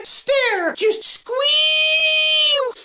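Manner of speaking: high, long-held cartoon wail; straining bear cry. Bears shoulder to shoulder powering up their stare, high straining wail. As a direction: squeeze the word out high and stretched.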